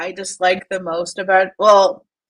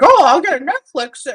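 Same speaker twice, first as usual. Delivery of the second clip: higher, louder mock childish boast; childish Jason; 6 semitones higher, 6 dB louder than usual. Mocking Jason bragging about Netflix, childish and higher. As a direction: brag like a kid who believes it. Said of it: "He's like a child".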